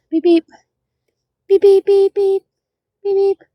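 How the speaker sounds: high little horn beeps; playful beeping. Pretend. Beekeeper working through the frames, playful high beeping. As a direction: pipe out short, high beeps.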